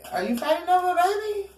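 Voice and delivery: high mock-outraged question; scandalised. Dramatic question to viewer.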